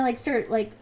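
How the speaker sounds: ordinary talk, nothing marked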